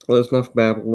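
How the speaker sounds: normal talking voice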